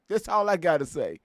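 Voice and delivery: ordinary talk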